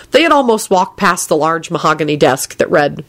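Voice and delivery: plain reading voice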